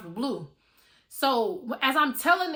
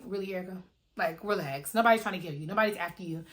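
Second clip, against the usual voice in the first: lower-pitched imitation of an unbothered man; dismissive man; 4 semitones lower, 4 dB quieter than usual. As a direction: brush her off in a low mock male voice. Imitating man brushing off her fear.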